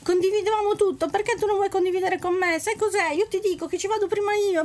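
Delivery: high, whiny puppy talking. Acted. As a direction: plead like a hurt little puppy. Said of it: "Il cucciolano".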